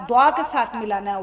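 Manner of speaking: usual speaking voice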